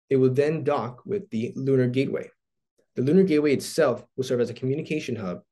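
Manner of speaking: usual speaking voice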